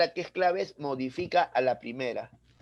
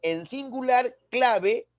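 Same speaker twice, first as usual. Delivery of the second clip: singular and clave pushed higher; stress on singular; 6 semitones higher, slower than usual. Shown as a demonstration. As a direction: lift singular and clave to set them apart. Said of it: "Y es más".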